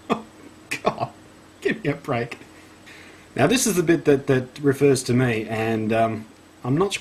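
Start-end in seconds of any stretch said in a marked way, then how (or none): 0.1-2.4 exasperated. Real, not acted. Exasperated reaction to the overblown article.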